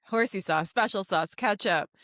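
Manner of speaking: usual speaking voice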